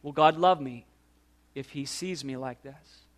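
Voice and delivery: soft, low question sinking almost to nothing; understated shame. Acted. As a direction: voice shame quietly, dropping away at the end. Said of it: "Shame says".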